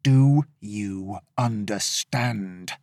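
slow, menacing through clenched teeth; through gritted teeth. Acted. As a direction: grit your teeth, slowly. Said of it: "through gritted teeth".

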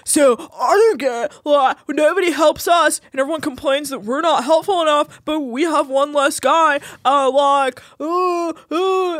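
mocking Eddie. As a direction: whine it up high like a sulky deckhand. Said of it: "Eddie's like".

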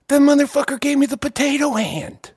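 high, rising comic outrage; indignant rant. Retold or imitated. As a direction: build from aggrieved complaint to loud demand. Retold belated realization, indignant rant.

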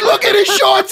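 very high, loud mock wail; hammed-up hysterical teasing; imitating crying with laughter. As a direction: shout it as if weeping with laughter. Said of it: "And he's literally crying".

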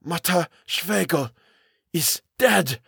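high, slow, halting dread; stammering fear. A narrator's reading. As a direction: force the words out haltingly. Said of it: "Kurtz stammered".

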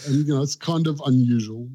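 normal talking voice

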